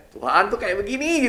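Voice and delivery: angry complaint. Retold or imitated. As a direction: whine it like someone fed up with God. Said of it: "kadang-kadang kita udah marah gitu".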